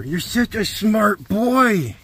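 high, very slow cooing praise. Real, not acted. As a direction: stretch it out like talking to a puppy. Cooing at the dog.

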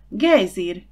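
loud, sudden cry of discovery; exclaimed cry. A narrator's reading. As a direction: call it out to a companion. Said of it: "kiáltott fel Zsuzsi". (hangos felkiáltás)

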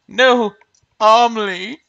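desperate cry of grief; anguished shout. Acted. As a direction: yell the name as your friend vanishes. Friend dies in the game.

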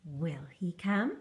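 plain reading voice

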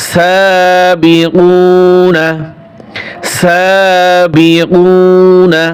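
সাবিকুনা chanted very slowly, vowels held long; long drawn recitation. Shown as a demonstration. Teacher models সাবিকুনা for students, slow and drawn out. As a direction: stretch out সাবিকুনা for the class. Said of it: "এগুলো এক আলিফ টান হবে".

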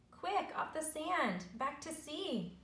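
high, drawn-out urgent plea; urgent cry. A narrator's reading. Snail urges beached whale back to sea, crying out. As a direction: cry out a warning, pitched well up. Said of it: "cried the snail".

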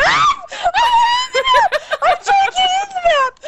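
very high, frantic squeal with laughter; panicked shrieking. Real, not acted. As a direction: shriek it in a rush. Panicking over game escape.

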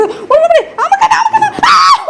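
very high, loud laughing squeal; real laughing scream; laughing shriek. Real, not acted. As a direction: shriek through laughter as the pet lunges. Pet lunges, she laughs.

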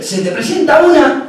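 ordinary talk, nothing marked

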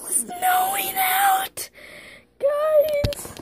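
very high, drawn-out excited squeal; thrilled squeal. Real, not acted. Vlogger telling viewers it is snowing, high excited squeal. Vlogger spots snow falling.

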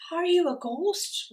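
plain reading voice

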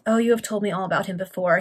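ordinary delivery

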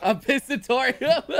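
slow laughing disbelief; laughing question. Real, not acted. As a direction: ask it while cracking up. Laughing disbelief at joke word.

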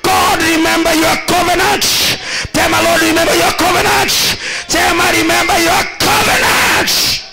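fervent prayer call over music; preaching. Acted. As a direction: lead the parents in loud prayer. Leads congregation prayer.

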